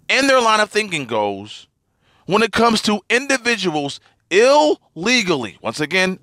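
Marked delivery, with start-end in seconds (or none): 4.3-5.6 "illegally" split after a drawn-out "il"; emphasis on illegally. Real, not acted. Podcaster pressing his point about Democrats, stressing "illegally". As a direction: lean hard on each part of "illegally". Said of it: "emphasizing the word illegally".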